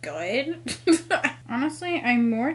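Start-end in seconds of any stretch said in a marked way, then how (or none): 0.6-1.4 brief higher-pitched chuckle; amused laugh. Real, not acted. Reader admitting the book isn't good, chuckling. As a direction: let a short laugh slip out.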